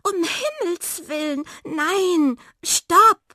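high, building to a loud shout; alarmed cry. A narrator's reading. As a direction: cry out to stop them at once. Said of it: "rief Miranda". (hoher, entsetzter Aufschrei)

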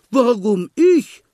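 high, loud startled squeak; frightened protest. A narrator's reading. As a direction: recoil from the idea in alarm. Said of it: "protestierte Schweinchen erschrocken".

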